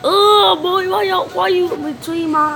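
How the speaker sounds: high, loud, indignant; startled outcry. Real, not acted. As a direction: protest the ambush. Complaining mid-fight.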